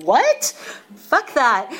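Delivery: shrieked high, loud what then a blunt curse; outraged shock. Retold or imitated. Hearing what rehab will cost, a loud high shocked refusal. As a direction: balk loudly at the price.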